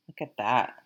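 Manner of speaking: broad Vermont vowels; Vermont accent. Real, not acted. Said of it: "My Vermont accent really came out there".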